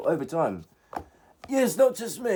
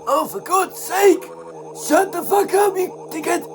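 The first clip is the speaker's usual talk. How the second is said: high, exasperated yell at the chat; exasperated outburst; 8 semitones higher than usual. Real, not acted. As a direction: snap at the message in disbelief.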